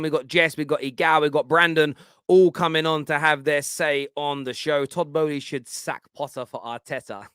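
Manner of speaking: ordinary talk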